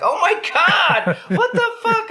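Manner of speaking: very high, drawn-out mock shriek; wife's horrified reaction. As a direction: imitate a disgusted woman wailing up high. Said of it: "And she's like".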